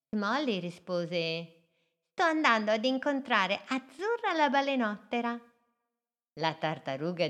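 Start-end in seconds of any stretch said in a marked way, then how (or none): 2.1-5.4 higher and quick; eager small boat; little boat voice. Acted. Little boat tells the turtle where she goes, high and fast.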